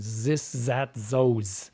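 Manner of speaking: put-on th turned to z, German-accent style; mock German accent, hard z for th. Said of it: "people doing German accents are often going".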